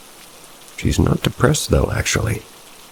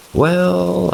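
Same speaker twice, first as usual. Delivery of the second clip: put-on girlish high voice.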